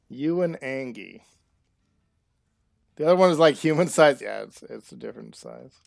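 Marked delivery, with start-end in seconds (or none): 3.0-4.2 loud and higher, eager to share; excited aside. Real, not acted. Streamer adding trivia for chat, loud and eager. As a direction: jump in with the fact, much louder.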